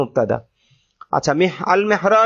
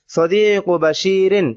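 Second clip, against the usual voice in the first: slow dictation; much slower than usual. Shown as a demonstration. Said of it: "teacher repeats example".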